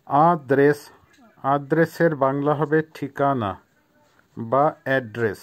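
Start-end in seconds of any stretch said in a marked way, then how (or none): none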